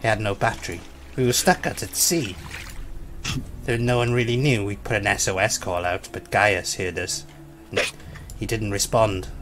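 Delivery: measured mock documentary narration; survival documentary narrator. Player narrating the raft trip as a survival episode, mock-solemn. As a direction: recount it like a grave survivor interview, voice sinking at the ends. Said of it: "I Shouldn't Be Alive episodes".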